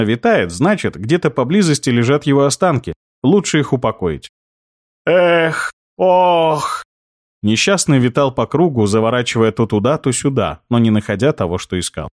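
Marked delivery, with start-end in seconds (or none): 5.1-6.8 high wailing ghost sighs; ghostly moans. Acted. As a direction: moan like a wandering spirit. Ghost moans while circling.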